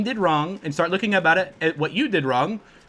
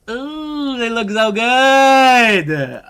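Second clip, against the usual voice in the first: drawn-out admiring ooh; 3 semitones higher, 5 dB louder, much slower than usual. Real, not acted. Streamer gushing over new skins, slow swooning ooh. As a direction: savour every word, dazzled.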